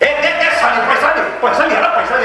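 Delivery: put-on high-pitched drunken demanding; acted aggressive demand. Drunkard demands money from behind.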